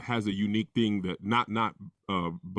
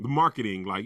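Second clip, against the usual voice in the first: ordinary talk, nothing marked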